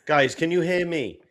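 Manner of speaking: loud, high call to the group. Real, not acted. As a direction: call out to check they hear you. Shouting to check the audio.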